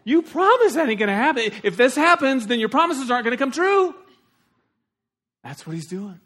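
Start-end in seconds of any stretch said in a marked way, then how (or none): 0.0-4.0 high, loud and frantic, begging God; desperate pleading prayer. Acted. Jacob begging God to keep his promise, frantic and loud. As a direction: cry out to God as if your brother is coming to kill you. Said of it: "So he's saying".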